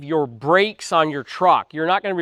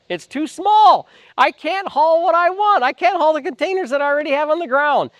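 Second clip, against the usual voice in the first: retold frustrated complaint, pitched higher and slowed; frustrated customer; 9 semitones higher than usual. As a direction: whine it like a fed-up buyer who got the wrong truck. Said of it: "And he was very frustrated".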